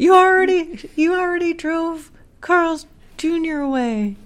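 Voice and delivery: hammed-up nagging wife voice; whiny scolding wife. Mocking Grace scolding Edwards.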